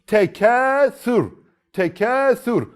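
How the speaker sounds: slow high recited "Tekâsür" with heavy final ra; thick ra recitation. Shown as a demonstration. Teacher reciting the next example word slowly.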